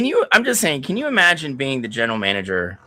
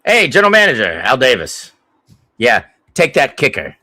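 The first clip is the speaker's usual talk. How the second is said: mock bossy owner, louder; Al Davis; 4 semitones higher, 8 dB louder than usual. Owner calls his GM on draft day, loud mock bossiness. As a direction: bark the order like a pushy old owner. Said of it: "Nothing, Mister Davis".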